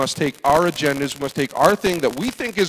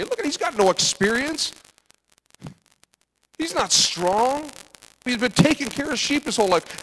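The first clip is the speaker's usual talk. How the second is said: much higher, complaining voice; a higher mocking brother's gripe; whiny brother; 10 semitones higher than usual. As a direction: whine it like an offended older sibling. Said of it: "The brother's going".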